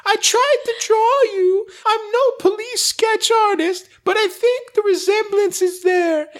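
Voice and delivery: very high, thin woman's voice; high squeaky voice. Acted. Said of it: "reading ad in put-on voice".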